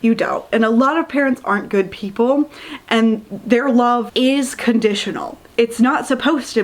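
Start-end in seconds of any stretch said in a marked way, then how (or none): none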